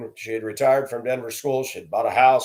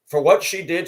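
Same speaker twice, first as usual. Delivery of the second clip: ordinary delivery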